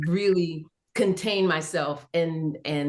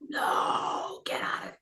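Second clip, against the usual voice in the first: unvoiced, much softer whisper; whispered disbelief; 6 dB quieter than usual. Reacting secretly at the table read, in a soft whisper. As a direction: whisper it so nobody at the table hears. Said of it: "And I was like".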